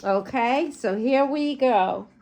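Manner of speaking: ordinary delivery, nothing marked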